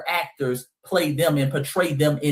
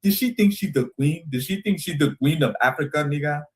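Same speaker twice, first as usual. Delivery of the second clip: mocking complainers; 3 semitones higher than usual. Creator mocking critics of [name], indignant put-on voice. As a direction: scoff it like a huffy critic. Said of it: "They were complaining about that".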